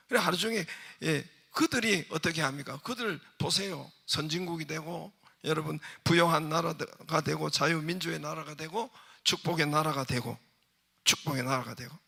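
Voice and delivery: ordinary talk, nothing marked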